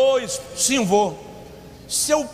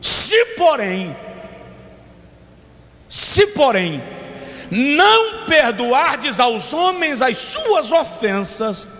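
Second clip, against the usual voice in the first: preaching at full force; much slower than usual. Acted. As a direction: proclaim the verse, stretching every word. Reads scripture at full force.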